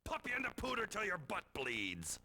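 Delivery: silly high threat. Acted. Sheriff threatening the newcomers, high and fast.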